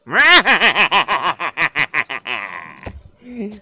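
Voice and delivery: loud, long maniacal cackle; loud villain laugh to close the show; evil cackle. Acted.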